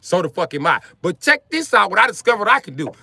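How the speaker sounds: loud, high hype voice; excited shout. Real, not acted.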